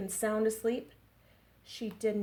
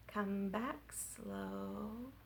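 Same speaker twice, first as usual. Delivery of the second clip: very slow, much softer; 10 dB quieter, much slower than usual. Shown as a demonstration. Children's rhyme, slow line sung drawn-out. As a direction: crawl through it.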